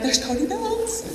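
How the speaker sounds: high, slow, hushed squeal; starstruck hush. Retold or imitated. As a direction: squeak it softly so the idol won't hear. Hushed starstruck aside to friend, retold.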